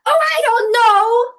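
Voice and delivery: very high, very loud imitated yell; upset outburst. Boyfriend answering what the man said, upset shout. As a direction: blurt it out at full volume, mimicking someone upset. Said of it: "was so upset".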